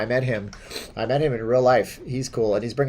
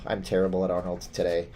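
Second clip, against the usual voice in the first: ordinary talk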